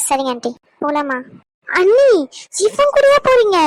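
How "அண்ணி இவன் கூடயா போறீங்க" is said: high, loud teasing yell; calling out in protest. Acted. Younger sister yells to her sister-in-law about going with him, loud protest. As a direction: call out high and loud in disbelief.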